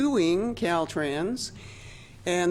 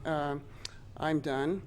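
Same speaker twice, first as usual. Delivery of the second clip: usual speaking voice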